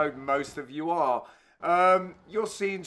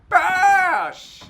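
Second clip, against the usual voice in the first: high, loud, drawn-out yell; shouted shot call; 13 semitones higher, 10 dB louder than usual. Real, not acted. Commentator calling the shot, loud high yell. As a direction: yell it out long as the ball is hit.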